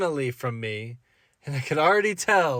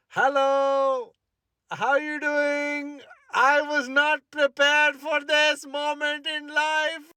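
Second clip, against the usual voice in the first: nervous high voice; 10 semitones higher, slower than usual. Acted. Nervous guy greeting his date's parents, high and slow. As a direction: voice jumps high and slow with nerves.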